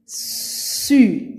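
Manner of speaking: "Su" with a long hissed s before the vowel; drawn-out S. Shown as a demonstration. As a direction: hold the first sound of "Su". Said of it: "j'entends S". (« Su » avec un s très allongé)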